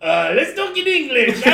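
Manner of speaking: high, loud cartoon Frenchman; hammed-up French accent, close to laughing; Pepe Le Pew French accent. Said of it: "just sounding like Pepe Le Pew".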